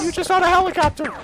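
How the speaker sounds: high, loud, rushed squeal; startled outburst. Real, not acted. Gamer reacts to the helicopter in game, startled high squeal. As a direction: squeal it fast and high in disbelief.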